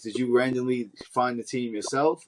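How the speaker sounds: normal talking voice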